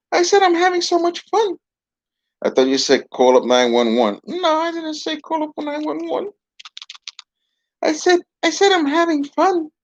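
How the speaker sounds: a dummy playing innocent; puppet voice. Puppet covers its words.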